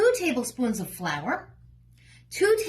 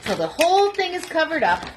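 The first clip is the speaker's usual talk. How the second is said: ordinary talk, nothing marked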